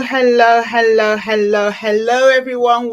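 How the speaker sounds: big, loud, drawn-out hellos to the audience; loud repeated hello; loud greeting. Real, not acted. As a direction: call hello out to the whole world. Host greets the audience, calling out.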